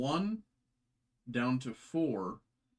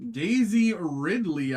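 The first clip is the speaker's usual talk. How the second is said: excited; 5 semitones higher, 7 dB louder, slower than usual. Real, not acted. Excited reveal of trading card.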